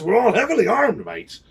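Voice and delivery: high, loud pirate swagger. Retold or imitated. Pirate showing the judge his pistol, swaggering.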